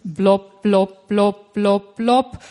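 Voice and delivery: low, plopping blop noises like water drops; dripping sounds. Retold or imitated. Preacher mimics water dripping in a cave, low plopping sounds. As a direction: imitate drops falling in a cave, one by one.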